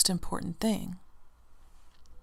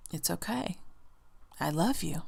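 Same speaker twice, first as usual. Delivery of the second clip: softer, hushed comfort; a soft, tender reassurance; 7 dB quieter than usual. Pretend. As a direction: tell yourself gently it's fine. Comforting self while sick with flu.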